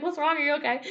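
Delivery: mock-concerned high voice; concerned Chris. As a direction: coworker checking on her. Chris asking Elsie.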